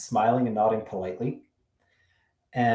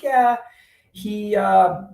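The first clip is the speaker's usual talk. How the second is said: high bubbly voice, a bit louder; high chirpy girl voice; 11 semitones higher, 6 dB louder, much slower than usual. Retold or imitated. Voicing the date's reply.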